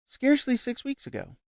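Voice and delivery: high, lively chatter; lightly voiced vivacious society lady. A narrator's reading. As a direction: bubble over with news. Gushing guest.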